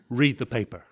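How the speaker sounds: usual speaking voice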